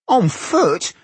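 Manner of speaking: high-pitched, incredulous echo; demanding question. A narrator's reading. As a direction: throw it back as an appalled, high question. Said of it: "demanded Goimgar".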